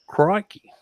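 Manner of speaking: high, put-on Australian exclamation; Australian accent. Showing friends what's Australian, mock Australian accent. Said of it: "That's Australian".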